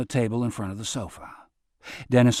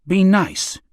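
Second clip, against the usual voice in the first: a higher, louder stern command; serious order; 8 semitones higher, 6 dB louder than usual. Acted. Robert ordering Jerry to behave, stern and louder. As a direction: clamp down firmly on Jerry. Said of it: "serious, like he was giving Jerry an order".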